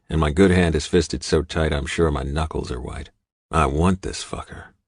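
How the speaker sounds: ordinary delivery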